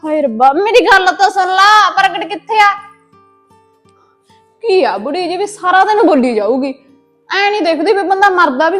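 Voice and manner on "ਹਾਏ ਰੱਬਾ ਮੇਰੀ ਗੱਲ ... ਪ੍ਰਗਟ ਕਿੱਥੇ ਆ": distressed wail. Acted. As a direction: cry out to God in despair. Addict wails for her husband.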